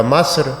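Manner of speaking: "مصر" said "Masr", with a instead of i; wrong vowel. Retold or imitated. Teacher quoting driver naming his country, "مصر" pronounced "Masr". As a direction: imitate the driver's "مصر" as "Mass-r". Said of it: "ملک کو بھی مصر کے بجائے مصر".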